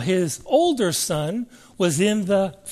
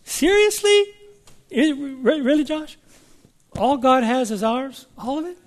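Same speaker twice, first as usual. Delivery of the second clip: much higher, disbelieving questions; mock incredulous doubter; 6 semitones higher than usual. Preacher mocking a doubter who asks Josh, much higher and incredulous. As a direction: squeak out the disbelief.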